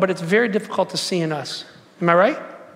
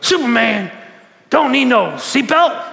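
slow, loud cocky boast; Muhammad Ali impression, louder and slower; 4 semitones higher, 4 dB louder, slower than usual. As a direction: strut through it like the champ. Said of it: "he kinda talk like that".

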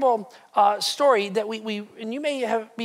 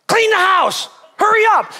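high, loud yelled orders; frantic shout; 10 semitones higher, 9 dB louder than usual. Acted. Father yells at his family to clean before [name] comes in. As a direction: bark at the family to hurry.

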